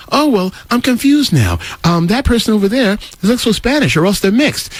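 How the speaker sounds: mocking confused quote.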